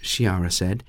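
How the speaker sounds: usual speaking voice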